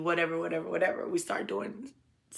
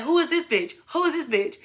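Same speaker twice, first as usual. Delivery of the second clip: high-pitched mock outrage, repeated insistently; high, indignant retold voice; retold angry demand; 8 semitones higher than usual. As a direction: imitate a jealous woman demanding answers. Retold angry baby mama confronting.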